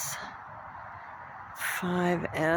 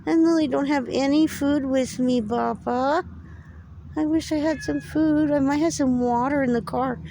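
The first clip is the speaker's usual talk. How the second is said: a bit higher, gentle talk to the cat; lighter pet talk; 12 semitones higher, 3 dB louder than usual. Real, not acted. Telling the stray cat she has no food, lightly raised pet talk. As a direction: tell the cat kindly about food.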